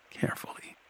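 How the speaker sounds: near-whispered caution; careful hush. A narrator's reading. Narrator on a troll swallowing at knifepoint, hushed. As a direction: drop right down to a whisper.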